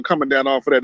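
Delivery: usual speaking voice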